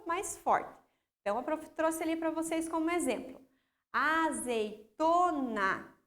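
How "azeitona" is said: "Azeitona" very slowly, split a-zei-to-na; syllable by syllable. Shown as a demonstration. Teacher showing pupils the stressed syllable, "Azeitona" syllable by syllable. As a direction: break "Azeitona" into drawn-out syllables, TO held. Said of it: "o to é a minha sílaba mais forte".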